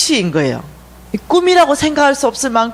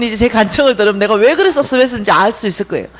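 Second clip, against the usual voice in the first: ordinary talk, nothing marked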